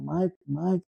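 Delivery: light mock call; imitated calling out. Retold friends calling for Michael.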